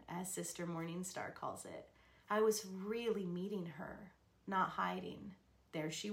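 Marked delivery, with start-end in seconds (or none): none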